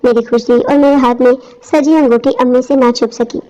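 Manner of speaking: usual speaking voice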